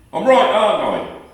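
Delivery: angry husband demanding agreement. Acted. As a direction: bark the question. Accusing his gossiping sister.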